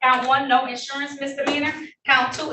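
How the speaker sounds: ordinary delivery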